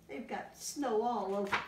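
ordinary delivery, nothing marked

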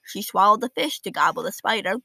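ordinary delivery, nothing marked

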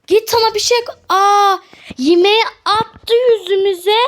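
high, loud telling-off; indignant yell. Real, not acted. Shopkeeper telling off the food-throwing customer, indignant yell. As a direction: shout him away in disbelief.

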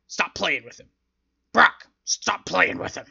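hammed-up boss shouting from ringside; imitating Vince yelling. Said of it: "Vince McMahon was literally yelling".

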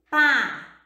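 "pá" said alone, loud and distinct; isolated syllable. Shown as a demonstration. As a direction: punch out "pá" as a single separate syllable. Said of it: "No início dessa palavra".

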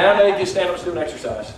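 ordinary delivery

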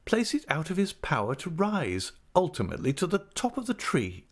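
a hint of a grand, fussing wife; Mrs Micawber voice. A narrator's reading.

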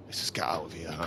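very soft, low, whispered aside; low whisper, energy gone; hushed muttering. Real, not acted. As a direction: murmur it to yourself, almost voiceless. Talks himself into leaving, fading.

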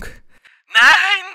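very high desperate cry; cry of despair. A narrator's reading. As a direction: let despair tear out in a high wail. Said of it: "in einen Schrei der Verzweiflung". (hoher Schrei der Verzweiflung)

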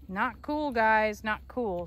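usual speaking voice

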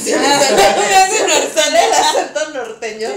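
laughing loudly, high; cracking up at herself. Real, not acted. Stylist laughing at her own Tijuana voice.

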